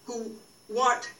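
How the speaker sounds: normal talking voice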